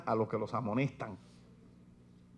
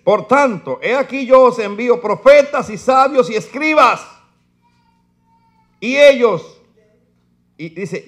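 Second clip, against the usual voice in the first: fiery preaching; 7 semitones higher, 13 dB louder than usual. Acted. Preacher reading woes to his church, fiery and loud. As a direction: thunder the verses at the congregation.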